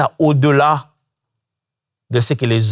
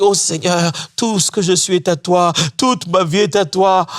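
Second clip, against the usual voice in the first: mock worship prayer; 6 semitones higher than usual.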